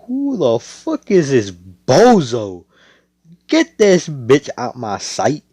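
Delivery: villain sneering at the hero, hammed up; mock tough insult. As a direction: look down on him and spit it out slowly.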